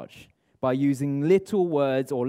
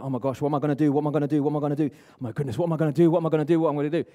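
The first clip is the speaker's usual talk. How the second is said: rapid-fire mock panic; acting out a panicker, words tumbling out in a rush; panicked; much faster than usual. Coach imitating people who are panicking, mock panicked babble. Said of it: "when they're panicking".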